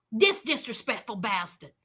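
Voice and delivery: ordinary talk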